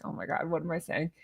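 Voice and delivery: normal talking voice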